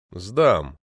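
low, resigned one-word reply; understated melancholy assent; glum promise. A narrator's reading. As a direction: give in drearily, pitch dropped. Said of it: "тоскуя пообещал я".

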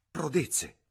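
startled exclamation; shocked cry. A narrator's reading. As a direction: recoil at the word. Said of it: "gridò Lucy".

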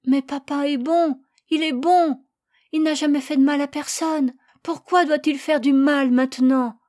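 put-on high-pitched, anguished child; distressed, trembling-lipped protest. A narrator's reading. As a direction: plead for an answer, upset. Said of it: "sortit encore de ses lèvres tremblantes".

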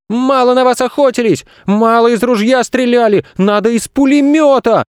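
put-on high-pitched furious child's rant; boy screaming. A narrator's reading. Boy raging at the zoo animals, screaming. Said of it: "закричал он на весь зоопарк".

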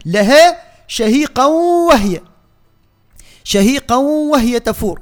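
slow chant gliding شهيقا into وهي on a held w; drawn-out merge from شهيقا to وهي; merged tanwin. Shown as a demonstration. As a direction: recite شهيقا وهي joined with no break. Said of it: "عندنا إدغام هنا".

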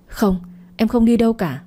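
cling on, she won't be sent away; refusing wife. Acted. (người vợ van nài không chịu đi)

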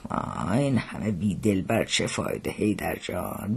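soft, low wistful sigh; wistful, sighed-out complaint; sighing lament. A narrator's reading. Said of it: "آهی کشید و گفت".

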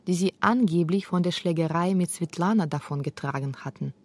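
usual speaking voice

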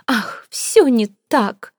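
slow, drawn-out sob of despair; theatrical sob; sobbing. A narrator's reading. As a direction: wail it like a fortune teller in grief. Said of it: "всхлипнула Сивилла".

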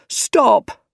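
high-pitched desperate cry. A narrator's reading. Harry held over the street by Doc Ock, crying out. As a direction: cry out in alarm. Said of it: "cried Harry".

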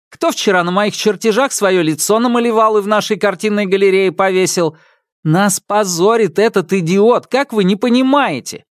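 high, heated list of accusations; furious accusation. Acted. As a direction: hammer each who did it. Brother lists robot's misdeeds.

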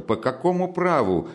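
high-pitched indignant challenge; sharp rise in protest; offended cry. Acted. Offended sister challenging her brother, indignantly. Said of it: "вскричала обиженная девушка".

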